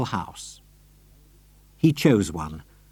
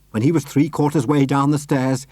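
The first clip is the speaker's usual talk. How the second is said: ordinary delivery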